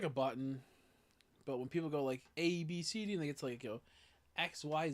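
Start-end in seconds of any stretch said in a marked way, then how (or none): none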